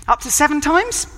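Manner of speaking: high, questioning voice of Peter; Peter asking. Acted.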